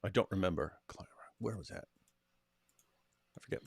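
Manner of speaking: soft breathy whisper to himself. Real, not acted. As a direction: whisper it, half forgetting. Trails off into a whisper.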